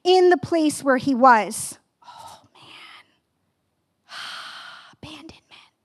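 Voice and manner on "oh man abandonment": breathy whispered lament. Acted. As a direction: whisper it like a sigh of despair. Whispered lament opening sermon.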